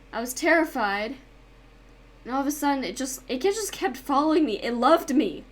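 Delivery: usual speaking voice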